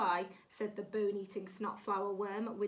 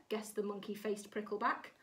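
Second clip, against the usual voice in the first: plain reading voice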